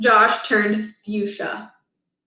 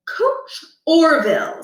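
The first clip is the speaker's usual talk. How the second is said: stern principal; 7 semitones higher, 4 dB louder, slower than usual. Acted.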